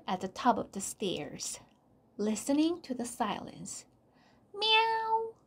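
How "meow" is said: high, sustained cat meow; long, level high-pitched mew. A narrator's reading. Narrator voices the cat's meow.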